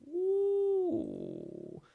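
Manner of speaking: a high teasing ooh. Real, not acted. Reacting to a chat question, high intrigued ooh.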